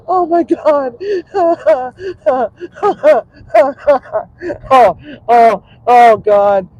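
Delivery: laughing hard, high and loud; hysterical laughing. Real, not acted. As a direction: crack up helplessly at the memory. Cooking fail recalled, big laugh.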